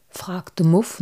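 ordinary delivery, nothing marked

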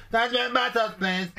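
very high, squeaky put-on caller voice; silly prank voice.

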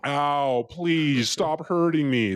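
badly faked pain, dragged out; mock pleading. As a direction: plead in pretend agony, slowly. Mocking his plea to small cop.

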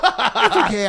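high put-on visitor voice; Kenny. Co-host as the rebuffed friend answering the host, high-pitched. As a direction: squeak it out high. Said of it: "That's gonna be Kenny".

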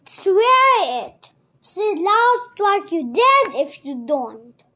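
loud, forceful order to swear; threatening command. A narrator's reading. Captor forcing an oath on the boy, loud threatening command.

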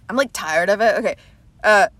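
ordinary talk, nothing marked

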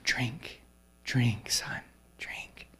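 soft whisper sinking away; hushed repeated whisper; whispered prayer. Retold or imitated. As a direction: breathe it like a frail old woman praying. Old lady praying again.

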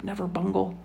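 ordinary delivery, nothing marked

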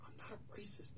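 hushed, defensive denial; acted-out whispered retort; whispered protest. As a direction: hiss it back under your breath. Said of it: "they're kinda whispering back and forth".

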